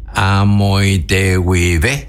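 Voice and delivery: "amoité huive" dicho despacio y claro; Guarani demo. Shown as a demonstration. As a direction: spell out "amoité huive" slowly, Guarani sounds. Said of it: "quiere decir vengo desde allá". ("amoité huive" pronunciado lento y marcado)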